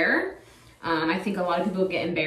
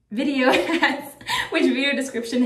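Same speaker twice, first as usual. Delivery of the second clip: words broken by a real laugh, pitched up; 6 semitones higher than usual. Real, not acted. Vlogger to viewers about finding her handle, laughing. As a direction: giggle through the word.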